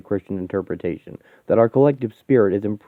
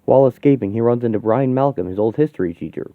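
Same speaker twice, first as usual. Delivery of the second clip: ordinary delivery, nothing marked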